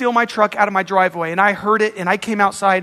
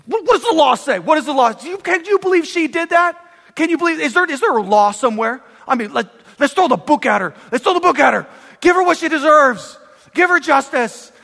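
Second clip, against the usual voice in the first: agitated, higher-pitched outraged rant; upset king ranting; 6 semitones higher, faster than usual. Acted. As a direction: act out an indignant king demanding punishment. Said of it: "He's so upset. He's like".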